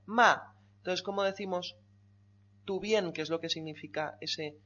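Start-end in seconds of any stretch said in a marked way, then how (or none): 0.1-0.5 "ma" loud, falling sharply; fourth tone. Shown as a demonstration. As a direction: hit "ma" high and drop it. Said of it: "Y luego". ("ma" en cuarto tono, caída fuerte)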